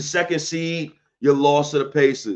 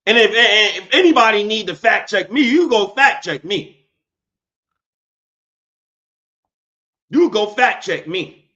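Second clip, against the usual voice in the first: defiant challenge; 8 semitones higher, 7 dB louder than usual. Real, not acted. Challenging viewers to fact check.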